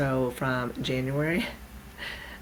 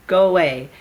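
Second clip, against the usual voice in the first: loud mock brush-off; dismissive shoo; 3 semitones higher, 10 dB louder than usual. Teller shrugging off the nudge on her shoulder, loudly dismissive. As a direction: shoo the nagging voice away loudly. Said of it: "shrugging it away".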